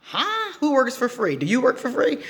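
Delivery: high disbelieving "Huh?" and questions; can't believe anyone would ask; incredulous. Real, not acted. Asking viewers in high disbelief who works for free.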